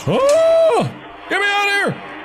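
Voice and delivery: very high, panicked shriek; panicked squeal. Real, not acted. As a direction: shriek to be let out. Panicking to escape in game.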